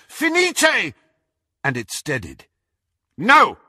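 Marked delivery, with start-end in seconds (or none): 0.1-0.9 high, ringing incantation; cried spell. A narrator's reading. Harry casts at the rampart, high cry. 3.2-3.6 high, loud command to stop; shout. A narrator's reading. Malfoy stops Crabbe's spell, urgent shout. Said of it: "shouted Malfoy".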